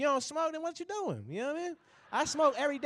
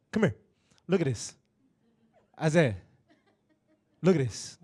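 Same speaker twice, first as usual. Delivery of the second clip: lower, gruff repeated summons; lower, gruff old granddad calling Isaiah; 5 semitones lower than usual. Retold or imitated. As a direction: call the grandson over again and again like an old man. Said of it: "He'll be like".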